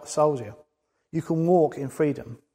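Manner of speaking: normal talking voice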